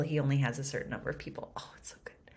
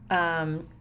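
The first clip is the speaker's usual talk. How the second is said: ordinary delivery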